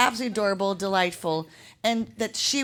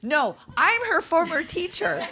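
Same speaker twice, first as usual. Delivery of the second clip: proud teacher; 8 semitones higher than usual. Retold or imitated. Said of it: "It's like".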